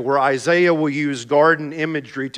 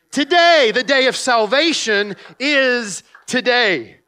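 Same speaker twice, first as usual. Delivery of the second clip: fervent preaching; 7 semitones higher, 4 dB louder than usual. Acted. Preacher exhorting the congregation.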